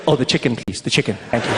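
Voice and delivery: ordinary talk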